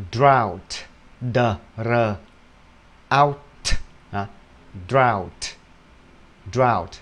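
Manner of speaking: "Drought" with the "out" vowel, "d-r" blended; drought demo. Shown as a demonstration. Teacher drilling students on "Drought" like "out". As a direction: rhyme "Drought" with "out". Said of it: "mà là out".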